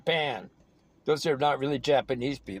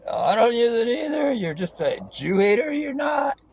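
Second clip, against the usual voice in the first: high, raised-voice mock outburst; mock retort; 8 semitones higher, 3 dB louder than usual. Answering a quoted commentator's dodge, high heated mock retort. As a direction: jump up high and throw it back at him.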